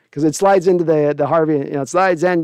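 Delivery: ordinary talk, nothing marked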